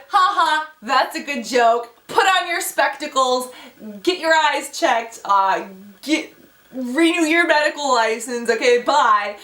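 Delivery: mock laugh, then slow sarcastic brush-off; hammed-up, drawn-out dismissive retort; sarcastic dismissal. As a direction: send them packing, slowly and emphatically. Mock dismissal of technician's bad news.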